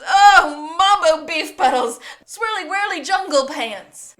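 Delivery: loud, high nonsense outburst; hammed-up psychic ranting; mock vision babble. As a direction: blurt the gibberish like a prophecy. [name] babbling fake vision gibberish.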